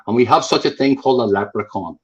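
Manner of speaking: ordinary talk, nothing marked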